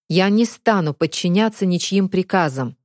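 ordinary delivery

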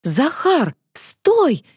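high-pitched cry to stop; squeaked call. A narrator's reading. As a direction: squeak it out, jumping high on the last word. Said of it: "пискнула Варя". (пискнуть, окликая друга)

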